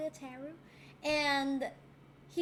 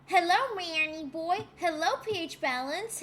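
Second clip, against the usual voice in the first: ordinary talk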